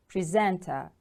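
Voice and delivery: "Presenter" with the stress on SENT; second-syllable stress. Shown as a demonstration. Teacher models the word for repetition, "Presenter" stressed on SENT. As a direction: lean on the middle of "Presenter": pre-SENT-er. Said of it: "The stress is on the second syllable".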